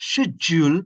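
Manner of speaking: "schedule" first as SHED-jool, then SKED-jool; UK and US variants. Shown as a demonstration. Teacher offers both accepted versions, alternative pronunciations. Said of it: "শিডিউল, এখানে ডা sound নাই".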